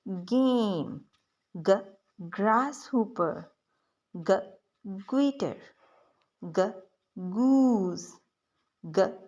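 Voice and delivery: "ग" sounded alone, then each word drawn out very slowly; teacher slowly modelling "ग" words for kids; slow phonics drill. Shown as a demonstration. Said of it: "इनके साथ इसको ग sound से पढ़ लेते हैं".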